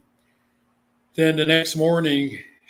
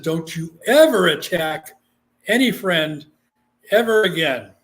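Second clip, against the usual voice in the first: stern command; 2 semitones higher, 6 dB louder than usual. Real, not acted. Commanding doll while sprinkling holy water.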